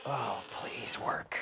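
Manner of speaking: slow whispered begging; whispered plea. Real, not acted. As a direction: breathe the plea softly. Begging car to start.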